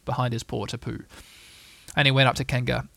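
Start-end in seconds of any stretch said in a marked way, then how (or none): none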